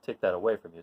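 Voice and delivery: usual speaking voice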